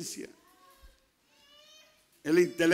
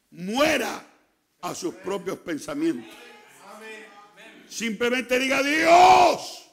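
loud, high preacher's cry, pressing each phrase; full-force preaching; 6 semitones higher, 5 dB louder than usual. Acted. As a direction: preach it hard to the congregation. (predicación a todo pulmón)

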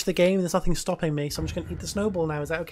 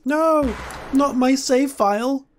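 hammed-up panic; 9 semitones higher, 5 dB louder, slower than usual. Fake YouTuber panic.

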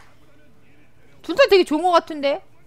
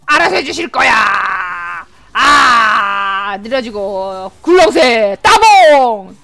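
slow, stretched loud holler; drawn-out battle yell; 4 semitones lower, 7 dB louder, much slower than usual. Real, not acted. Player cheering on a team fight, drawn-out yelling. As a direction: yell it long through the fight.

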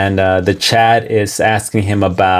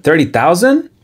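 rising, higher echo of the price in disbelief; surprised repeat; 7 semitones higher than usual. Retold or imitated. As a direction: echo the number as if you can't believe it. Streamer reacts to chat's price.

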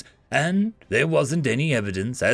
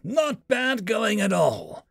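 higher, slow dry taunt; pinned soldier taunting the alien slowly; wounded soldier; 8 semitones higher, slower than usual. Acted. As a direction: needle him while pinned.

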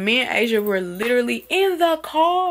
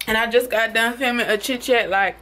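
ordinary delivery

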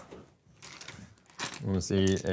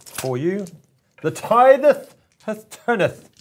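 high loud theatrical pronouncement; mock-archaic proclamation; 11 semitones higher, 7 dB louder, slower than usual. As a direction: declaim it like an old herald.

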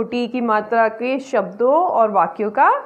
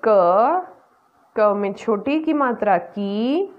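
normal talking voice

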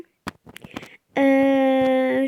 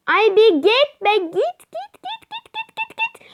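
frantic shooing; 11 semitones higher, 5 dB louder, slower than usual. Real, not acted. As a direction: pitch shooting up higher and higher on git. Shooing an enemy in game. (gittikçe tizleşen panik içinde kovalama)

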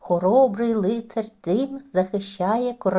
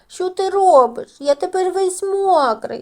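high, loud, squeaky wet little mouse; squeaky upset mouse; 9 semitones higher, 7 dB louder than usual. A narrator's reading. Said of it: "пискнув він". (високий писклявий голос мишеняти)

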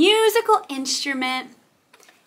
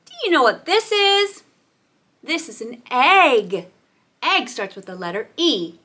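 ordinary talk

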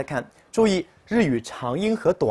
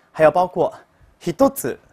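ordinary talk, nothing marked

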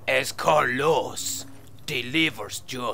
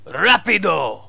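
fake Spanish accent; 4 semitones higher, 8 dB louder than usual. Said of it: "your fake Spanish accent".

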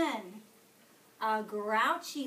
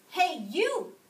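higher, louder brash hail; grouchy call-out; 7 semitones higher, 10 dB louder than usual. A narrator's reading. Grouchy ladybug hails the yellow jacket, loud and brash. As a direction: call out a challenger rudely. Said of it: "said the grouchy ladybug".

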